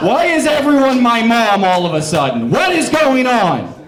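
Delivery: loud, high exasperated outburst; mock exasperation. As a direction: cry out in hammed-up bewilderment. Mock outburst at hostile crowd.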